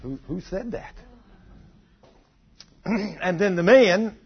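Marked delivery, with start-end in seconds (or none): 0.0-0.9 very soft, stammered wondering; small, halting, retold unease; hushed startled question. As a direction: play a wife timidly asking an unseen voice. Wife startled by unseen voice.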